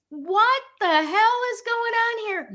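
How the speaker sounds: mock bewildered what-the-hell. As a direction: throw it up high in disbelief. Retelling her bewildered moment.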